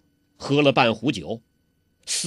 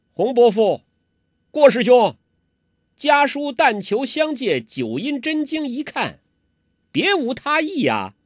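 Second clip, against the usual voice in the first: put-on higher, ingratiating plea from a sly young man; smooth young schemer; 9 semitones higher than usual. 欧阳克讨好地向洪七公求经. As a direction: coax them sweetly, hiding his intent. 欧阳克 voiced in story.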